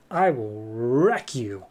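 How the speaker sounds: slow villain threat, drawn out; hammed-up, slow menace; menacing threat. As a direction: stretch it out like a cartoon demon's warning. Demon threatens the hero.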